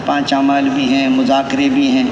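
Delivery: ordinary talk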